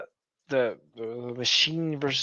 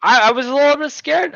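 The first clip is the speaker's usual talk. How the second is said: excited; 11 semitones higher, 9 dB louder than usual. Real, not acted. Retelling nerves, high burst.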